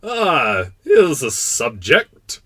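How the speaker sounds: loud, drawn-out royal delight; king's exclamation. Acted. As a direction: exclaim grandly as a pompous king. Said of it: "the king exclaimed".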